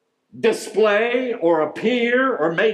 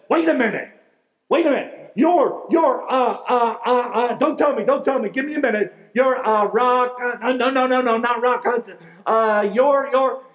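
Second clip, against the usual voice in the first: higher, stammering, fast excited fan; 6 semitones higher than usual. Retold or imitated. Fan on the street grasping for Cary Grant's name, excited. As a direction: blurt it out like a starstruck stranger. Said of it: "And with excitement, the man said".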